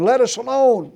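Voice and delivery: high, loud terrified cry of a demon. Retold or imitated. Unclean spirit cries out to Jesus in the synagogue, loud high terrified cry. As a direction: shriek it out like a cornered devil. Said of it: "And he cried out".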